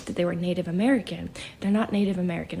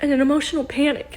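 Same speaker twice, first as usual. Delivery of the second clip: ordinary talk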